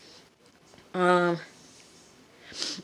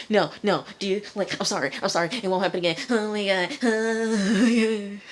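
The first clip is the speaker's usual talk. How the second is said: imitated panicked pleading, climbing higher; mock freak-out; 4 semitones higher, faster than usual. Tied-up Craig begging his captors, mock freaking out. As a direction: beg in a rising, hammed-up panic. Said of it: "Craig is freaking out".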